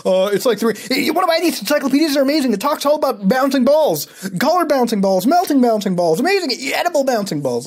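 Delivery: high, loud, fast sales pitch; hyper telemarketer. Acted. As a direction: hype the bouncing balls like a manic salesman. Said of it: "pretend calling from different time zone".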